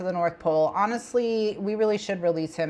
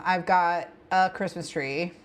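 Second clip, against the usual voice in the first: ordinary talk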